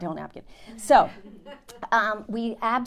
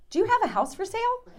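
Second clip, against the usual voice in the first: much higher, quick excited woman's voice; eager stranger voice; faster than usual. Retold or imitated. Barbara asking Melissa about the house, eager and high.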